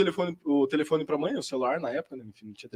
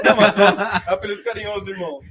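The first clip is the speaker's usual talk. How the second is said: laughing; 5 dB louder than usual. Real, not acted. Laughing off the nickname.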